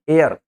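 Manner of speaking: एयर begun straight on the vowel; silent H. Shown as a demonstration. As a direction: leave the H out of एयर. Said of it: "एच को छोड़ के बोलना है". (एयर, एच छोड़कर)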